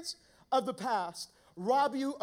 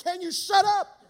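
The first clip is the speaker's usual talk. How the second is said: retold snap; 7 semitones higher, 8 dB louder than usual. Snaps at the passengers.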